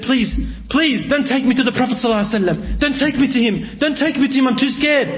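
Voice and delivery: high, desperate begging; mild frightened plea, pitched high; frightened pleading. Retold or imitated. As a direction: beg high and fast, terrified of being taken. Tha'laba begs not to be taken.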